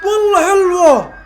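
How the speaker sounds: very high, loud, stretched exclamation; excited cry. Real, not acted. Fan reacting to a near goal, excited cry. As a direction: explode at the big chance.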